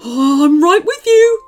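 loud, high, eager dog voice; excited calling. A narrator's reading. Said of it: "called the dog excitedly".

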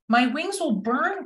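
high, lightly voiced owl cry. A narrator's reading. Owl fears the forest fire, mild storybook cry. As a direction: swoop up high in mild alarm. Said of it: "cried Owl".

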